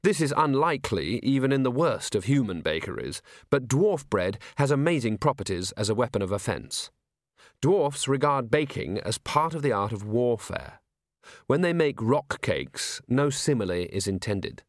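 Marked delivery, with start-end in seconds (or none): none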